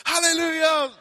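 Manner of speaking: high, loud imitation of a roaring crowd; big ringing mock praise cry; congregation's hallelujah shout. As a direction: holler it like a whole church answering. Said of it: "And the whole place goes".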